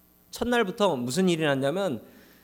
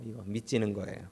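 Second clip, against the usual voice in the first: hushed mock merchant; 7 semitones lower, 10 dB quieter than usual. Merchant voiced in sermon joke.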